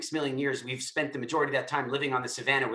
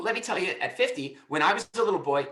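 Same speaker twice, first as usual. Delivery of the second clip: ordinary delivery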